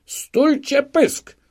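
very high, loud, drawn-out shout; stretched scream of outrage; enraged interruption. A narrator's reading. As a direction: cut in with a furious bellow. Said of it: "przerwał Szwejkowi rozwścieczony przewodniczący komisji".

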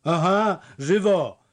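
high, loud simple-minded parroting; idiotic echo. A narrator's reading. The village idiot repeating the fisherman's command, foolish echo. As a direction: parrot the shout like a halfwit. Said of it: "powtórzył idiotycznie znajda".

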